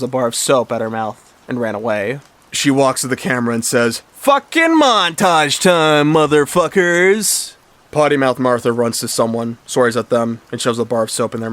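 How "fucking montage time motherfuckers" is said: high, loud, stretched-out proclamation; acted rowdy hollering; brash announcement. A narrator's reading. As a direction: announce a rampage slowly and loudly. Martha announces rampage to camera.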